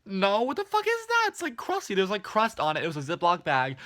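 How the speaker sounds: very high, squealed disbelief; loud mock-horrified shriek, easing down; grossed-out outrage. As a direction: act out revolted refusal at the top of your range. Retold revulsion at crusty bag.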